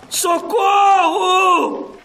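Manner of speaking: sobbing scream for help; damsel in distress. Acted. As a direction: cry out in terror, slowly. Captive screams, crying.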